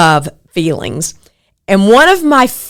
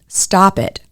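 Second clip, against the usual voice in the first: ordinary talk, nothing marked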